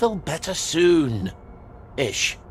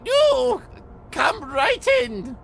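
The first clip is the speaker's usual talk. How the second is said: much higher, slow, called out; flustered falsetto; much slower than usual. Acted. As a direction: panicky overly polite welcome. Ollie flustered at the door.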